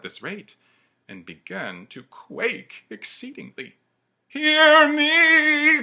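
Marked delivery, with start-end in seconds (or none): 4.3-5.8 very high, loud ghostly command; commanding cry. Acted. The ghost commands Scrooge to listen, very high and loud. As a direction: demand he listen. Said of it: "cried the ghost".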